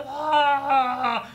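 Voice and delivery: high, loud drawn-out scream; yelled scream. Retold or imitated. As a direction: imitate the horrified man yelling "Ah". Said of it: "He was yelling".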